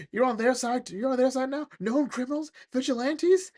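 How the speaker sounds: high-pitched, hammed-up disbelief; incredulous accusation. Officer turning the gun on his partner, mock incredulous.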